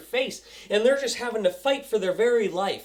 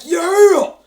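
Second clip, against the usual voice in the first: higher, much louder cheer; excited cheer; 8 semitones higher, 10 dB louder than usual. Retold or imitated. Fan finishing the episode, cheering loud. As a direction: cheer like your team just won. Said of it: "finished it and I was like".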